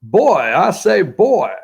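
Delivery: blustering mock Southern voice; hammed-up Southern holler; put-on Southern accent. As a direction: imitate a Southerner, pitch swooping up. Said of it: "somebody says".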